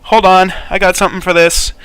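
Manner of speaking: high, loud and flustered; flustered hurry. Acted. Aris scrambling to revive the guard she hit, flustered. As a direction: hastily promise a fix, rattled. Character hurries to heal victim.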